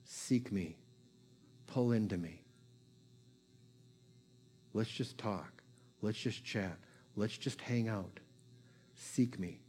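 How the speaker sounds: softly coax, like a loving father; God speaking gently. Retold or imitated. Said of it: "he'll just say".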